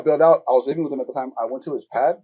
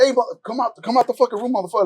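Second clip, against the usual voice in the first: high, heated call through a door; retold angry summons; angry demand; 8 semitones higher than usual. Banging on his homie's door, angry demand. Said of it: "I start banging on his door".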